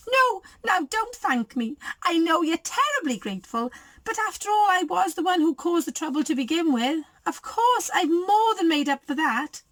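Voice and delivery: high-pitched, self-satisfied chatter; gushing Goldie. A narrator's reading. Goldie races on past protests, gushing high. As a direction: gush on in a high voice.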